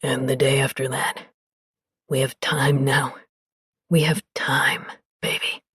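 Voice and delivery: low and soft, sinking to a whisper; tender low voice fading to whisper. Acted. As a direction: murmur lovingly, then whisper.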